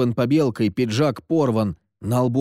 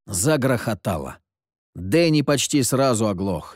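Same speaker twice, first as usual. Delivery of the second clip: ordinary delivery, nothing marked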